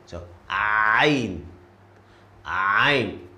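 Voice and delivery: 'Ain' from the throat, said plainly; Arabic letter Ain. Shown as a demonstration. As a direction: model 'Ain' again for practice. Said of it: "comes from the middle of your throat".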